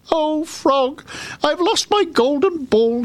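high, wailing princess; crying. A narrator's reading. Princess tells the frog of her lost ball, crying. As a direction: wail it high, slowing on the loss. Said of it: "she cried".

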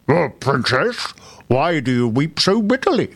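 odd croaky storybook frog. A narrator's reading. Frog at the spring asks the weeping princess, in frog voice. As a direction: speak as a talking frog. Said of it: "a frog suddenly put his flat green head out".

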